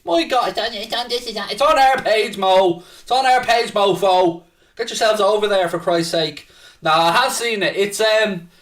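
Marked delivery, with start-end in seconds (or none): 0.0-6.5 higher, indignant rant; mock outrage. Real, not acted. Streamer berating a viewer for promoting another site, mock outraged. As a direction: blow up in playful fury at the chat.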